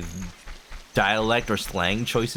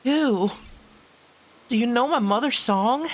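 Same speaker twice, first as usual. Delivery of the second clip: voicing Malon; 11 semitones higher than usual. Streamer voicing Malon dialogue.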